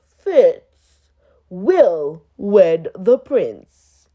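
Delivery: loud, grand herald voice; royal proclamation. Acted. A herald reads the king's proclamation, loud and grand. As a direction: announce it to the whole kingdom.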